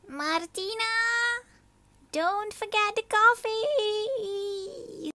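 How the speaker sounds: high, slow call from afar. Acted. Abuela calls down to Martina from the balcony, high and slow. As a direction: call down to her.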